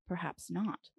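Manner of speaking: ordinary delivery, nothing marked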